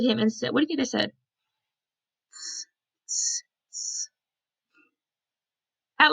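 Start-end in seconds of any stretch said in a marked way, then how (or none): 2.3-4.1 short voiceless tss sounds, softly; monkey hissing tss. A narrator's reading. Monkeys answer the peddler with soft hissed tss. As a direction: hiss back like the monkeys, in short bursts. Monkeys' mocking reply in story.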